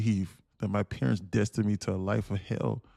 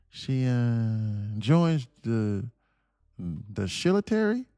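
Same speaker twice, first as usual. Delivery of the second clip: Biden impression; 4 semitones higher than usual. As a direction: stammer through names like Joe Biden doing a speech. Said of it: "I was talking like Biden".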